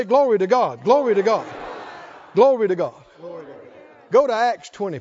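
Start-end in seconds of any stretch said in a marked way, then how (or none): 0.0-2.9 loud praise call. Shown as a demonstration. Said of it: "Oh, somebody say".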